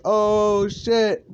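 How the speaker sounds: high, drawn-out oh-shit reaction; shocked realizing. Acted. Whole class seeing who is in bed, high shocked call. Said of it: "they're not making fun, they're just, like, realizing".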